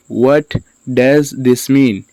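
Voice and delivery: slow, with a pause after "What"; slow word-by-word. Shown as a demonstration. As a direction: teach "What does this mean" slowly, words apart. Teacher repeats word by word.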